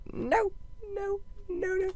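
very high squeaky no; panicked squeal. Real, not acted. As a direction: squeal in dismay. Squealing as character falls.

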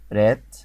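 رات said loud and alone with a long open vowel; repeat-after-me model. Shown as a demonstration. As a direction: give رات firmly for the learner to echo. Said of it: "قول ورايا كده".

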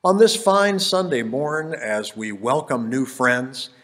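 rhythmic Dr. Seuss style reading; bouncy galloping verse. Acted. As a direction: bounce through the rhymes playfully. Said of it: "wrote in Anapestic tetrameter, and it has that rhymy sound".

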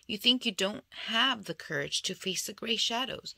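usual speaking voice

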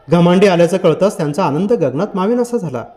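plain reading voice

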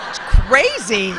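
laughing quote. Retold or imitated. Boss's reaction retold.

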